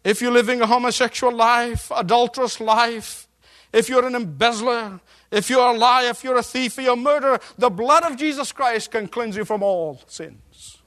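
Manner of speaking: full-force pulpit preaching; fiery preaching. Acted. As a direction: rouse the congregation.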